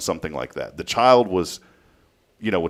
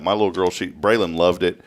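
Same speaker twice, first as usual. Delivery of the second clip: normal talking voice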